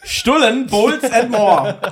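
high, slow slogan; slogan read. Pretend. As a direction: sell it like an announcer. Reading the slogan in a pitch.

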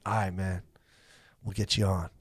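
low, soft, laid-back promise; cool agreement. Retold or imitated. Rudy to comic offering a spot, cool low-key agreement. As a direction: say it quietly and casually.

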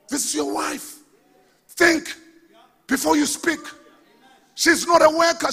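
high-pitched pulpit admonishing; insistent preaching. Real, not acted. Pastor correcting spouses on speech, insistent high preaching. Preaches at congregation about marriage.

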